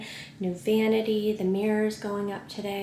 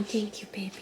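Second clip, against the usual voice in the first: whispering; 8 dB quieter, faster than usual. Real, not acted. After baby's kiss.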